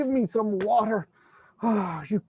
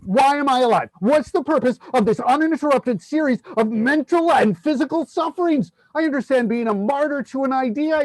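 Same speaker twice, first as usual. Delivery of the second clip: loud, raised, ranting outburst; shouting; 5 semitones higher, 4 dB louder than usual. Acted. Ranting to his friend about his miserable life, loud and high. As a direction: rail at your own life at full voice. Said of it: "Don't shout".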